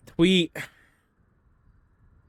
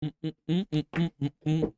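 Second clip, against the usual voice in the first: savouring mm; 7 dB quieter than usual. Real, not acted. Savouring chocolate.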